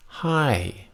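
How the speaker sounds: High starts high and falls; high falling tone. Shown as a demonstration. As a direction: drop High sharply from a high pitch.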